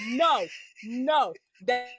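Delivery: normal talking voice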